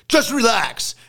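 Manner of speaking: high, loud, imitating a big calming voice; imitating Joe's big voice. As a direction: boom it out like Joe calming everyone. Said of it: "in that way that Joe's got that big voice".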